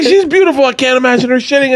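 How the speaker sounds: high-pitched mock bewilderment; dismayed friend. Imitating a friend dismayed about his fiance, high and bewildered.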